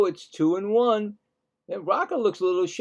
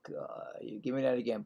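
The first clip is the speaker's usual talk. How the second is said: imitating a whispered plea; 5 semitones lower, 13 dB quieter than usual. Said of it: "He's like".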